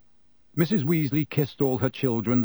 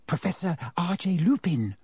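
high, unvoiced whisper; 6 semitones higher than usual. A narrator's reading. Girl naming the sleeping stranger to friends, whispered. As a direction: whisper the name to your friends. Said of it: "whispered Hermione at once".

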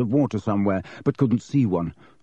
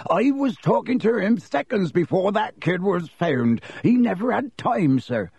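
Hagrid, urgent; 10 semitones higher than usual. A narrator's reading. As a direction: burst in begging to be heard. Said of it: "said Hagrid urgently".

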